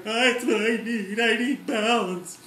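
high, loud, slow mock proclamation; overt declaration. Yoga student hammily declaring need to instructor, slow and high. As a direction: announce your need dramatically, slowly. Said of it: "I didn't really do it quite that overtly".

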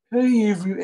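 put-on high, loud pitiful little-boy voice; pathetic Dondi voice. Comedian in costume doing a pathetic little-boy voice. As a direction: play a pathetic little kid begging.

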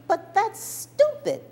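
put-on high, slow little girl's outraged protest; a hurt child spelling out the unfairness; indignant child. Little girl on the bus tells her mother, high and indignant.

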